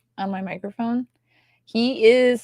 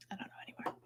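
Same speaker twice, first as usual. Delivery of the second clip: whispering; 24 dB quieter, much faster than usual. Real, not acted. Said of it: "whispered aside".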